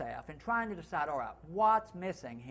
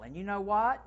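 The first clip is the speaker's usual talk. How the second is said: ordinary talk, nothing marked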